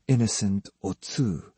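ordinary delivery, nothing marked

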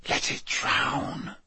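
slow, soft, voiceless whisper; whispered thought. A narrator's reading. Harry thinking as the creature sinks, slow whisper. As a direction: wish it silently, in a slow whisper.

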